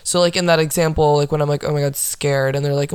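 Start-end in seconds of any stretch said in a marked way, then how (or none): 1.7-2.5 deadpan, low-pitched mock fear; understated, dry quip; dry joke. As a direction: deliver it super dry, as a throwaway joke. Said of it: "it's just super dry".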